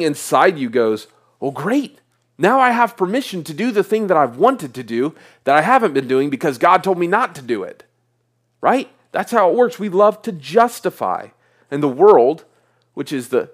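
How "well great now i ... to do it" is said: eager excuse. Acted. Voicing the flesh's eager excuse.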